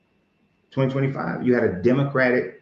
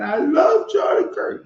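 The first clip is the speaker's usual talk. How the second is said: high, wailing sob, mocking a crying fan; hammed-up blubbering cry; slower than usual. Mocking a man crying over Charlie Kirk, hammed-up wailing sob. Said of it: "he was mad and crying".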